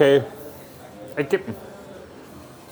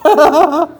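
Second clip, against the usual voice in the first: loud laugh; 9 semitones higher, 6 dB louder than usual. Real, not acted. Lachen im VR-Spiel.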